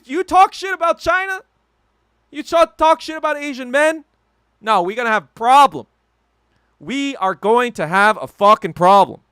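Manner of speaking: heated yelling rant, pitch spiking high; furious shout. Real, not acted. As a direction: blow up at them, real anger boiling over.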